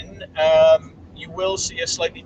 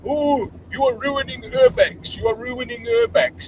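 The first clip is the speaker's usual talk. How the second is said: mocking critics; 9 semitones higher, 3 dB louder than usual. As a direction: sneer it back as the critics. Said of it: "all these people who keep telling me".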